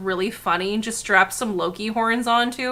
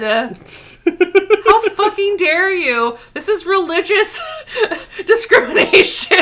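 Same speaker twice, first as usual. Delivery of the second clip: slow, louder hammed-up indignation breaking into laughs; mock outrage; 7 semitones higher, 6 dB louder than usual. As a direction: play offended through laughter.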